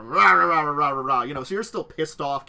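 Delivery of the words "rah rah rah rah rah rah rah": loud gibberish grumbling rah rah; mock angry ranting. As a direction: rant wordlessly like a furious sulker. Mock ranting of upset Carrie.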